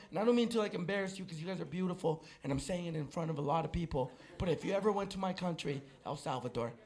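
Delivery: mock-secret murmur to the front row; hushed aside. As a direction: lean in and half-whisper the joke. Confidential aside to the ladies.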